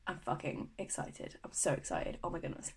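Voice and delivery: hushed, low excited half-whisper. Real, not acted. Telling viewers about her Bali trip, excited hush. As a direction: drop to a soft, thrilled murmur.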